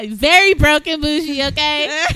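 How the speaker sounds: loud and laughing. Real, not acted. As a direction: laugh it out proudly. Laughing joke.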